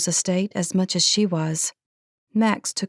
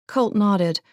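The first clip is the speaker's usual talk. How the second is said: ordinary delivery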